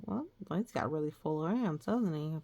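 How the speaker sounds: usual speaking voice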